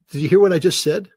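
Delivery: loud, high, incredulous outburst; nearly shouting, pitch jumping up; excited exclamation. Real, not acted. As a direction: demand they realise what you said. Exclaims at his own point.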